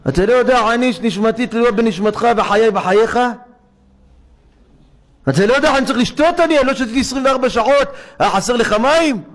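high, fast, urgent reproach; an old rabbi demanding why water is missing; urgent rebuke. Retold or imitated. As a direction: press him hard about the water. Rabbi Akiva rebuking his disciple.

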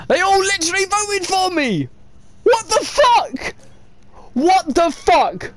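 very high, outraged cries; incredulous yelling. Real, not acted. Player everyone voted for, incredulous high yelling. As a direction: yell in utter disbelief. Disbelief at everyone voting for him.

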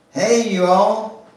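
excited high call to friends; Emily calling her friends to the mirror, excited. Acted. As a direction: call them over, bright and loud. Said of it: "she said".

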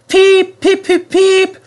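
very high, piping train peeps; fussy whistle. A narrator's reading. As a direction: pip impatiently like an engine whistle. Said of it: "fussed Edward".

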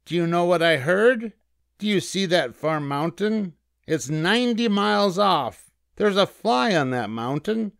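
high-pitched, self-important rabbit voice; cocky little rabbit storyteller; bragging rabbit. A narrator's reading. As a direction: show off a tall tale, pitched high. Rabbit brags of his hearing.

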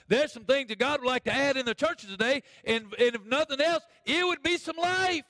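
high, ringing pulpit cry building to a peak; full-force preaching. Real, not acted. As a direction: proclaim it to the whole church.

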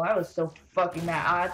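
ordinary delivery

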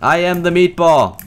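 booming proud declaration; hammed-up triumphant roar; mock battle cry. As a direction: bellow it like a cartoon villain. Gamer bellows his catchphrase.

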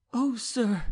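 high, pleading cry of a flogged serf; high-voiced appeal. A narrator's reading. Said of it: "he cried".

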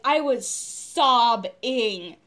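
"sobbing" broken into long slow syllables; dramatic emphasis. Real, not acted. Drives home how hard she cried.

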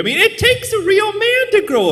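very high, loud squeaky voice; high squeaky voice for a macho line. Shown as a demonstration. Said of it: "always like to say it like that".

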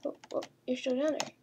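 normal talking voice

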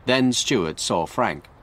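ordinary delivery, nothing marked

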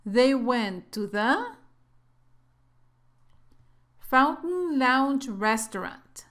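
slow, clear model reading; careful teacher model sentence; slow read-aloud. Shown as a demonstration. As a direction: read it slowly for students to repeat. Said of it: "en voz alta".

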